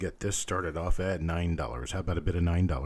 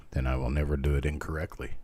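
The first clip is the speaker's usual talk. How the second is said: ordinary talk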